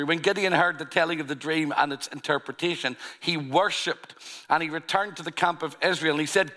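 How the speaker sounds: unhurried scripture reading voice. Acted. Scripture reading resumes after aside.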